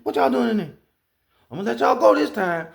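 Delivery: high-pitched comic threat; tornado as nosy bully. Retold or imitated. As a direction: peer in and holler, playfully. Voicing tornado peeping into a house.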